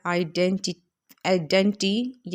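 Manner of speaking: Identity- slowly broken into separate beats; split into syllables. Shown as a demonstration. Teacher counts four syllables, saying identity- slowly in pieces. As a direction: spell out identity- by sound, piece by piece. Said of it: "चार syllable होते हैं".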